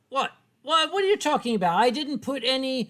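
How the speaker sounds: high, loud protest; mock indignation. Artist answers copyright accusation, loud mock-indignant protest. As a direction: push back loudly at a false accusation.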